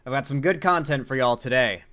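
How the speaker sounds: normal talking voice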